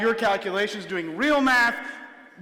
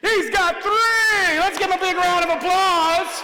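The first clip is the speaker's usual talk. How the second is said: much higher and louder, first words drawn out slowly; excited announcement; 12 semitones higher, 7 dB louder, slower than usual. Real, not acted. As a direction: whip the crowd into applause. Announcing the trick's success.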